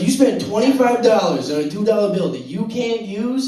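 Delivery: loud, high disbelieving question; incredulous. Acted. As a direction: scold dad in amazed disbelief. Acted incredulous scolding of dad.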